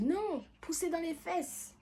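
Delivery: high, slow and firm, correcting her; slow high voice correcting the pushing; bossy midwife. Retold or imitated. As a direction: play the midwife setting her straight, pitched high.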